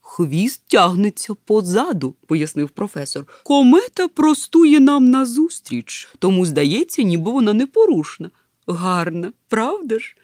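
loud, high, enthusiastic professor; професор, захоплене пояснення. Acted. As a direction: explain it emphatically, stretching key words. (захоплений голос професора)

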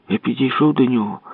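usual speaking voice

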